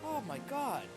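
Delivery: ordinary talk, nothing marked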